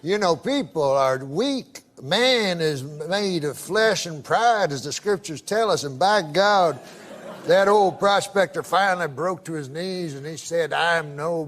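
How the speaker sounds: put-on low, drawling Southern old-timer voice telling a joke; Southern accent. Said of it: "I can tell by the accent".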